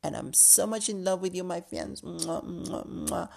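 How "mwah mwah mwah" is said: soft kissing sounds; blown kisses. Real, not acted. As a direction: blow kisses to the fans. Blowing kisses to fans.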